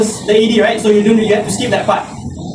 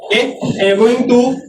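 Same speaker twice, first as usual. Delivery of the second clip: usual speaking voice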